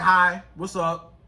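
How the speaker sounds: low, slow, dry greeting; indifferent. Acted. Woman showing how to answer a man's text, indifferent. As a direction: greet him like you don't even care. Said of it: "act like you don't even care".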